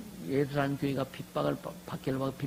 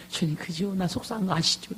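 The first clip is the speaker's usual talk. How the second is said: higher-pitched mock plea to the Lord; 하소연하는 기도 흉내; 6 semitones higher than usual. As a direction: act out a believer pleading for sympathy. 신자가 주님께 하소연하는 재연. (주님께 하소연하는 높은 목소리)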